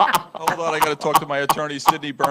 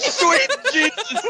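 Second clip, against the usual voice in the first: high, slow, laughing cry; laughing exclamation; 12 semitones higher, much slower than usual. Real, not acted. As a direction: cackle it out. Laughing reaction.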